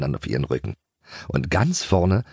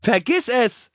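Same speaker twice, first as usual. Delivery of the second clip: high, cheeky call; laughing taunt; slower than usual. A narrator's reading. Pony Keks races past Dennis, laughing taunt. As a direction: toss it out as a merry taunt. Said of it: "lachte Keks".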